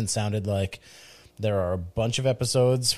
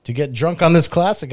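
ordinary talk, nothing marked

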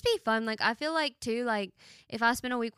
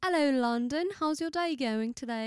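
lilting mock-British greeting; British accent; 3 semitones higher than usual. Shown as a demonstration. Repeating the accent demo for friend, playful British accent. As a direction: put on a British hello. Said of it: "do British".

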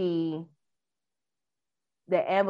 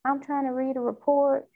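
higher, exasperated complaining; 5 semitones higher than usual. Real, not acted. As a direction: vent at the glitchy screen. Complaining about the touchscreen.

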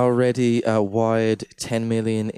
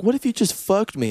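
higher coy mock woman; mock heroine; 10 semitones higher than usual. Mocking heroine.

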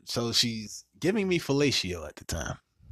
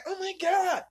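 shocked squeal. Retold or imitated. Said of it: "She was like".

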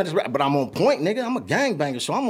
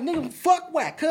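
angry comeback; 10 semitones higher, slower than usual. Retold or imitated.

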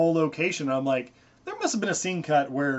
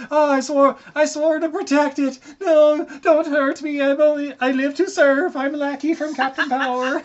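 a pleading servant with a put-on Irish accent, much higher; mock Irish lackey; 10 semitones higher, 6 dB louder than usual. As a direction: beg for mercy like a whiny Irish accented sidekick. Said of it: "has an Irish accent now".

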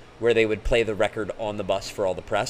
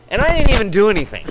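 high, loud and fast protest; exasperated shout; 11 semitones higher, 10 dB louder, faster than usual. Real, not acted. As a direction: shout it out in mock outrage. Exasperated punchline of his story.

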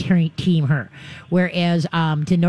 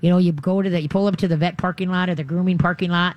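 ordinary talk

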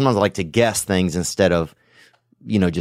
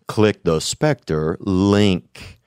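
drawn-out ad pitch; 2 semitones lower, much slower than usual. Acted. Ad read, slower still.

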